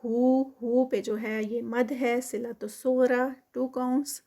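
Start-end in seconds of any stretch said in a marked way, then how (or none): none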